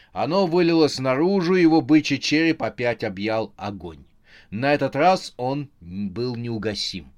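usual speaking voice

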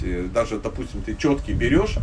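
ordinary delivery

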